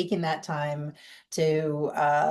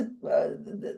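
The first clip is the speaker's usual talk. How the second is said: usual speaking voice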